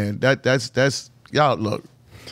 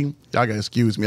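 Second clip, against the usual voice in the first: ordinary talk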